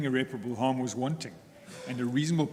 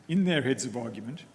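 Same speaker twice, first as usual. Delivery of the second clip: ordinary delivery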